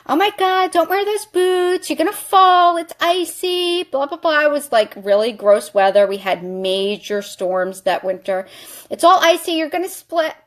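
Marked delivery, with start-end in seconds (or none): 0.1-4.5 high-pitched fretting mom imitation; nagging mother. Mother warning her about icy boots, high mock nagging. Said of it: "My mother's like". 8.9-10.3 lightly mimicked higher-pitched mom; a touch of a mother's nagging warning. Mother warning of a fall, lightly mocked nagging. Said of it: "My mother's like".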